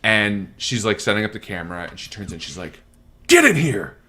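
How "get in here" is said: loud, high imitation of a mother yelling; imitated sharp shout; retold yell. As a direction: summon the kid with an acted yell. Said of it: "yells at him".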